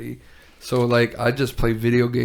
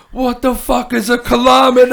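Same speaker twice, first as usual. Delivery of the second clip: mock outraged yell; 12 semitones higher, 8 dB louder than usual. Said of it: "And he's like".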